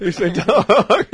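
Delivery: high, loud, slow laugh through the words. Real, not acted. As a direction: laugh it out, delighted by the dog. Laughing at dog on stream.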